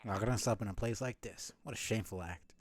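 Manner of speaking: ordinary talk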